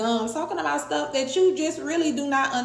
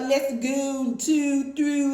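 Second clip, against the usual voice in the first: imitating a DC accent, slightly raised; mock DC accent; 3 semitones higher, much slower than usual. Mocking how people from DC talk, DC accent. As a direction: lightly caricature DC talk. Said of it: "If you're from D C, you're talking about".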